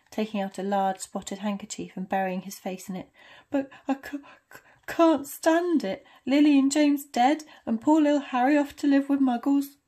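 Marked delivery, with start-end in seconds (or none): 3.5-9.8 bawling giant, stuttering through sobs; wailing through tears. Acted. Hagrid weeping over baby Harry.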